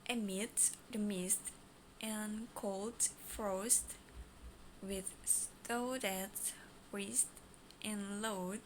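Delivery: slow, word by word; slow mode. Shown as a demonstration. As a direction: space each word out slowly. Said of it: "first slow mode".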